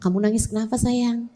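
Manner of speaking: put-on soft, gentle question to a crying child; tender asking. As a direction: ask softly and lovingly why. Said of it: "mau tanyain".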